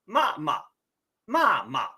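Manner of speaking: ma, ma, ma, ma with the second syllable in a light neutral tone; 轻声示范; Mandarin tones demo. Shown as a demonstration. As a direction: show the toneless second ma against the others. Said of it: "the second one doesn't have an accent over it".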